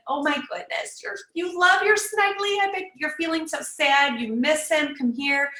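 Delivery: put-on gentle high comforting tone, like hugging a crying kid; comforting parent. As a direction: coo sympathy to the upset little one. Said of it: "They're met with".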